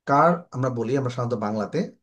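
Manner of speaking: ordinary talk, nothing marked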